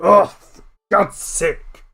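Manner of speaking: slow loud groaning oath; fed-up elder groan; disgusted groan. Acted. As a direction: groan getting up from the muck. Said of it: "It's like".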